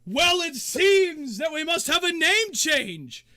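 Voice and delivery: loud, high, drawn-out proclamation; showman's slow, booming delivery; arena announcer. Acted. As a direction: announce it grandly to the stands. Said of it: "and the announcer goes".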